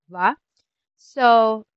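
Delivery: usual speaking voice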